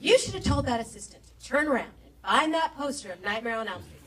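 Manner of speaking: loud, indignant rant as the sister-in-law; incredibly offended. Retold or imitated. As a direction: imitate an outraged relative scolding her, hitting key words hard. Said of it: "was incredibly offended".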